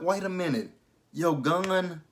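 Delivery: high-pitched disbelieving double-take; incredulous reaction. Retold or imitated. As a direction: stop short and question it, incredulous.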